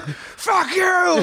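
very high strained mock yell; hammed-up furious heckler; mock outrage. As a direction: squeal it out in pretend fury. Imagined giant-victim cursing the comedian.

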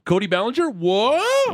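high, rising incredulous question; mock astonishment. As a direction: squeal the rumour as a teasing question. Mock excitement over trade rumours.

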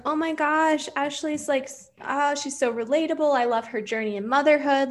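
lightly hammed-up adoration; mock gushing. Fan gushing over Ashley's journey in motherhood, mock gushing.

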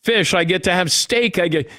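building excitement over imagined food; giddy anticipation. Retold or imitated. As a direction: rattle off the dream menu all excited. Imagining the foods he'll get.

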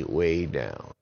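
ordinary delivery, nothing marked

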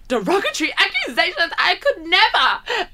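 gasp, then high-pitched pearl-clutching outrage; hammed-up shock, drawing out the last words; mock scandalised. Podcaster mocking an old cast member's pearl-clutching, scandalised.

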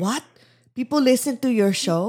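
put-on astonished dad question in a Filipino accent; incredulous Filipino dad. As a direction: imitate a baffled Filipino father. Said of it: "he was like".

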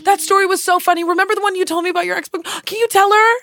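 high, gushing friend's plea; imitating excited friend. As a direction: push them eagerly to tell the story. Voicing friend pushing for a story.

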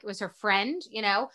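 usual speaking voice

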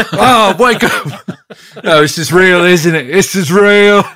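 desperate plea to wake; panicked. Acted.

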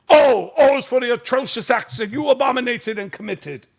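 high, loud imitation of a ranting father; hammed-up angry recital; mock tirade. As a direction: declaim it like a drunk dad breaking his son down. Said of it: "broke him down".

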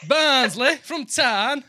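high, loud, drawn-out holler; slow comic bellow in northern accent; mock shout. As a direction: holler the town name back, stretching it out. Joins in the shouted reaction.

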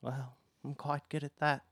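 lightly voiced meek servant; mildly timid character voice; nervous, scared underling. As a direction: offer a small, timid boast. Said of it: "he's so nervous and".

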